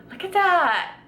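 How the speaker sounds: delighted, high and loud; excited. Real, not acted. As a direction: show it off with glee. Showing off the cobbler.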